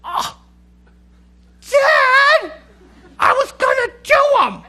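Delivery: put-on very high, loud teen whine; whining daughter. Daughter protesting dad did her chores, high whine. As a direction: wail the protest like a caught teen.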